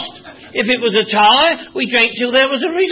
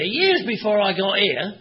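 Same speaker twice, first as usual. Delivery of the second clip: usual speaking voice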